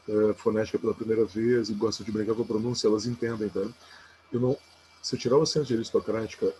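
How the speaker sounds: usual speaking voice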